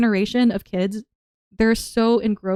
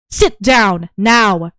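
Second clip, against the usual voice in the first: stern command; 7 dB louder, much slower than usual. Shown as a demonstration. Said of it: "bring out the, the evil voice".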